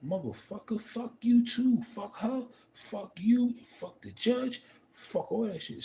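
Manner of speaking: high, loud cursing at the judge; retold blowup, voice jumps high and loud; angry courtroom outburst. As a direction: let it rip at everyone in the room. Retelling his outburst in divorce court.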